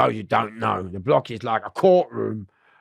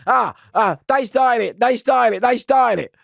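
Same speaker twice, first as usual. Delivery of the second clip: whining protest; 11 semitones higher, 5 dB louder than usual. Retold or imitated. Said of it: "Jamari's going".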